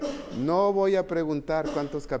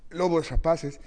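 ordinary talk

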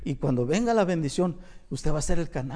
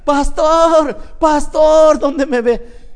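high, loud calling across the street; 10 semitones higher, 13 dB louder, much slower than usual. Retold or imitated. A once hostile woman greets the pastor, calling out loudly. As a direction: hail the pastor eagerly from afar.

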